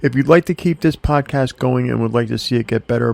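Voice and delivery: usual speaking voice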